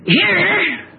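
high, ringing call for attention; emphatic preaching. Real, not acted. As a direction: call out sharply to the congregation.

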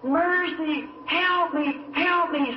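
high desperate cry, mother screaming for mercy; retold scream for help. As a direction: cry out for help from the flooded porch. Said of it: "just screaming".

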